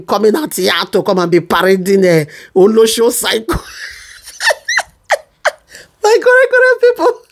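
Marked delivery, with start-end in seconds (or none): 6.0-7.2 high, bright-eyed call to followers, laughter bubbling under it; excited high greeting. Real, not acted. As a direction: call out to the crowd, pitched high and giddy.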